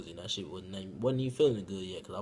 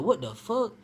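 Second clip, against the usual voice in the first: shocked exclamation; 10 semitones higher, 4 dB louder than usual. Retold or imitated. Retelling his shock at the mistake.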